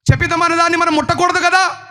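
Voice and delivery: high-pitched, demanding rebuke; stern confrontation. Retold or imitated. Preacher as Joshua questioning the assembly about sin, stern confrontation. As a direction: challenge the whole crowd, pitched high.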